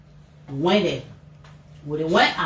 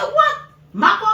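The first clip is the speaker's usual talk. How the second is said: sudden high, loud shocked cry; shocked outburst; 11 semitones higher, 5 dB louder than usual. Retold or imitated. Mother hearing who beat her son, shocked high cry. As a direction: blurt out in disbelief.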